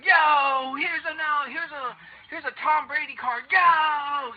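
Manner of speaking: high, loud hype-man yell, imitated; screaming seller. Imitating the card seller who screams at viewers, mock screaming. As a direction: holler the pitch with long stretched yo's. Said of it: "he just screams at you".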